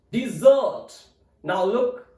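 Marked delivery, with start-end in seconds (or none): 0.1-0.9 "Desert" as di-ZERT, long second syllable; stress on second syllable. Shown as a demonstration. Teacher repeating the verb "Desert", stressed di-ZERT. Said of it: "The stress is on second syllable".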